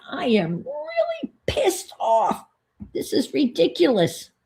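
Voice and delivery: high, slow pissed-off shout; angry yell. Retold or imitated. Shouting at the window guy on the phone, furious. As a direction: let the anger burst out high. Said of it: "I s-, yelled at him and I said".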